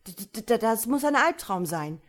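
loud, high-pitched stammering moan. A narrator's reading. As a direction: groan it out, stuttering on the first word. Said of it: "stöhnte auch ihr Mann". (stöhnend, stotternd)

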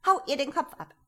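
high, loud, imperious command; shouted order. Acted. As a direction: bark the order across the kitchen. Duchess orders a beheading. (hoch und laut befohlen)

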